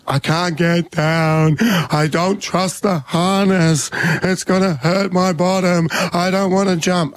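slow, high-pitched mock wail; imitated crying child. Daughter stuck on climbing wall, mock-tearful wailing. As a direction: drag the words out like a tearful kid. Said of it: "She starts crying".

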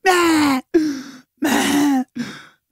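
imitating baby whimper. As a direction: squeak out a newborn's whimper. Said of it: "He's going".

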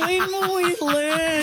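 high, drawn-out wail of mock despair; slow, high-pitched playful exasperation; mock lament. Real, not acted. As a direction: stretch it out high over the laughter. Mock lament during live-mic mishap.

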